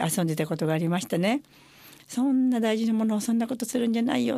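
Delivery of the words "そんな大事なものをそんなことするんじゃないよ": mild mock scolding, higher; a mother half-playfully telling the kids off; lightly voiced stern mother; retold scolding. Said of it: "ってお母さんがもう怒って".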